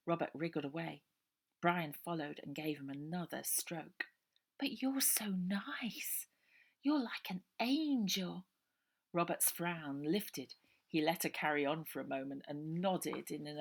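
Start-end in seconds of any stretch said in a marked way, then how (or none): 4.6-8.5 slow, higher whisper full of admiration; a dreamy kid cooing at a friend; awed whispering child. A narrator's reading. Brian admiring Robert.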